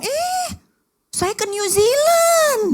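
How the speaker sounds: high, slow, loud show-off voice; high boastful interruption; interrupting boast. Acted. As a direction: cut in and brag over her. Acting out an interrupting friend.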